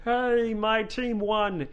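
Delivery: high, drawn-out mock cheer; sarcastic team-pride whoop; mock fan cheer. Mocking sports fans' celebration.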